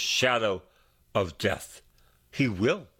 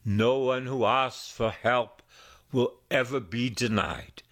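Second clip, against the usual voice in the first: ordinary delivery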